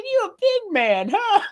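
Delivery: high sneering taunt; mock taunting woman. Quoting the woman mocking the robber, high sneer. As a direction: sneer down at a wannabe tough guy. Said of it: "She said".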